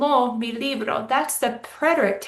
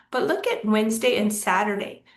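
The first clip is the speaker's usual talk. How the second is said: usual speaking voice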